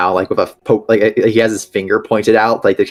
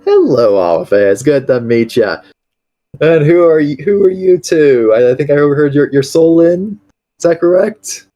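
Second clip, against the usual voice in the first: higher, hearty friendly voice; jovial greeter; 6 semitones higher than usual. Acted. Amon kneeling to greet the tiny fairy, hearty and warm. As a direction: greet them like a big friendly uncle.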